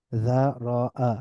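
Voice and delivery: যারাআ slowly as dha, ro, 'a; syllable by syllable. Shown as a demonstration. Teacher breaking down the word, যারাআ syllable by syllable. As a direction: split যারাআ into three drawn-out syllables. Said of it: "একটু মিলিয়ে মিলিয়ে পড়ব".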